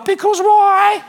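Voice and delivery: put-on high, slow whiny child asking why; toddler voice. Dad imitating his son's whiny question. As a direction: mimic a small boy's drawn-out question. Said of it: "Archer quote".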